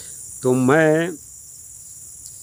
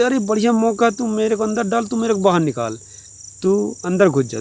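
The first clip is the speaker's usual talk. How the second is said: higher, eager, jumping at the chance; eager excitement; 7 semitones higher than usual. Acted. Shepherd seizing his chance at marriage, excitedly high. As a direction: grab the lucky break, pitched up and keen.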